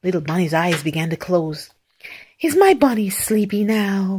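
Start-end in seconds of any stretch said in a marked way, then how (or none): none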